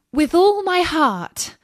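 put-on high, eager girl's voice. A narrator's reading. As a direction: accept gladly, lifted high.

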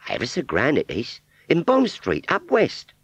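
put-on high Cockney girl's voice; rag trade Cockney. Scarecrow model answering Wurzel in high Cockney. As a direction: pert Cockney shopgirl reply.

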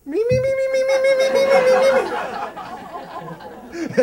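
very high, loud, steady Muppet bleat; nervous lab-assistant Muppet squeaking me-me-me; Beaker impression. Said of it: "do that impression".

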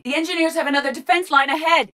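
very high-pitched alert; urgent combat warning. Acted. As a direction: call out the threat ahead.

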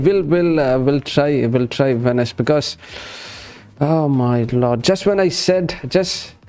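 ordinary talk, nothing marked

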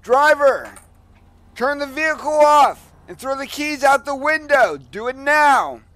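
booming police loudspeaker commands; bullhorn with siren. Real, not acted. Police stop commands over loudspeaker.

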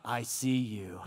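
very low, ominous God voice. Acted. God spotting someone who walked into church, deep voice. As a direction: sink deep down, playing an all-seeing God. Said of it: "and then God's like".

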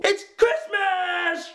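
slow, high, shouted announcement; excited shout. Acted. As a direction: shout it out with joy. Opening shout of Christmas video.

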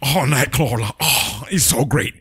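mock breathy, gasping fanboy; slow, panting swoon right into the mic; overexcited heavy breathing. Co-host mocking a comic book podcaster, breathy gushing. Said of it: "overly excited and he's breathing into the mic".

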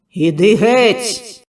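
loud, very slow, drawn-out shout; booming command. Acted. Healer booming at the demon, very slow. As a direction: let it echo over the square. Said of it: "Голос чудотворця луною рознісся".

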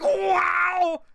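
high, drawn-out cartoon yowl; Earthworm Jim pain cry. Streamer showing the pain sound, high cartoon wail. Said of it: "like, pain sound where he's like".

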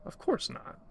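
high, soft creature voice; small creature. A narrator's reading. Creature reassures the narrator it means no harm, high and soft. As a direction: lift the voice high and keep it soft.

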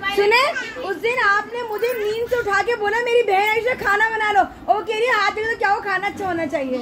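teasing grievance; mock complaint. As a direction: appeal to father-in-law with pretend hurt. Complaining to father-in-law.

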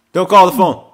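loud, high yelp; panicked protest. Real, not acted. Player to a stranger about to ring him, panicked shout. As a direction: shout it to ward off the call. Panics at announced incoming call.

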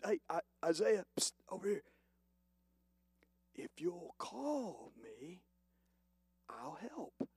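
a gentle beckoning almost-whisper; softly coaxing voice of God; hushed divine call. Acted.

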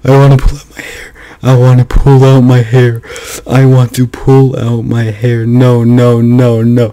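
loud, high-pitched despair; agonized gamer yelling at the loss; real frustrated outburst. Real, not acted. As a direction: wail it in sheer frustration. Gamer agonizing over a failed run.

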